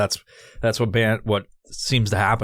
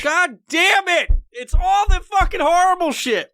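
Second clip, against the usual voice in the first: loud, high yell of disgust; screaming in real revulsion; disgusted shout; 4 dB louder than usual. Real, not acted. Disgusting jelly bean eaten.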